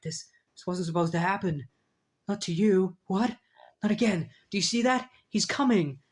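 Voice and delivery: a man coming apart in fear; panicked unraveling. Retold or imitated. Grieving hero sees Death coming, panicked and frantic. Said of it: "Mister Immortal's like".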